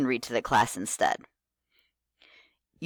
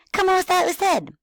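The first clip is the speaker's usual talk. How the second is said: put-on much higher, squeaky little kid voice; 5 dB louder than usual. Shown as a demonstration. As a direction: parrot it back like an eager child. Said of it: "talk very enthusiastically with a kid voice".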